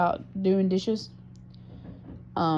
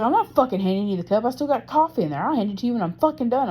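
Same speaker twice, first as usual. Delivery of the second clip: imitating an irritated partner, higher and louder; retold angry snap, pitched up; mad retort; 7 semitones higher, 5 dB louder, faster than usual. Speaker imitating partner refusing to hand over cup, mad. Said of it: "he was just mad as a motherfucker".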